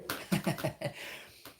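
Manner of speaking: high, airy laugh; amused storyteller's laugh; real laugh. Real, not acted. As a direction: laugh at your own retelling. Laughs at his own story.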